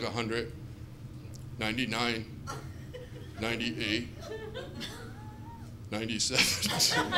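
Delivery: low, soft counting with long gaps; fading patient, low and quiet; drowsy countdown. Pretend. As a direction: count as if going under anesthesia. Patient counting down under anesthesia.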